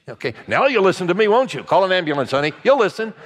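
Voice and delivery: put-on high, loud sing-song taunt of a parent who hurt the child; mock cruel parent. Parent mocking the child they pushed down the stairs, high loud gloating taunt. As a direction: ham up a smug parent gloating.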